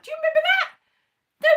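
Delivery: high loud squeaky question; squeaky plea. Real, not acted. Begging friend to remember, high and loud. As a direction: squeak it out pleadingly.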